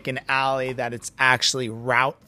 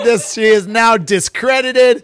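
higher, louder playful scorn; mock indignation; 7 semitones higher, 7 dB louder than usual. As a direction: declare her discredited, hammed-up. Mock verdict after "pop.

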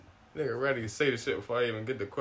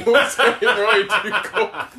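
laughing; 9 semitones higher, 9 dB louder, much slower than usual. Real, not acted. Laughing joke chant.

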